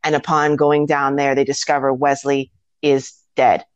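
ordinary talk

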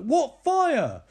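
high, loud mock disbelief; incredulous. Micah challenging Katie about the burned photos, incredulous. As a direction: cry it out in exaggerated bafflement, falling at the end.

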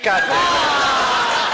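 slow, high, half-laughing curse; laughing exasperation. Retold or imitated. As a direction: drag it out, laughing in disbelief. His reaction to Weir Panther.

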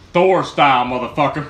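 ordinary talk

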